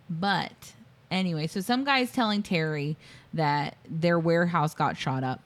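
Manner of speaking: ordinary delivery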